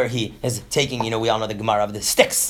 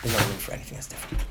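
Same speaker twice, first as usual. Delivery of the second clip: much softer, quick murmured repeat of "There's no room"; hushed echo; 5 semitones lower, 10 dB quieter, much faster than usual. Shown as a demonstration. Teacher echoing his point to the class, hushed. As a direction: drop it down to a low hush.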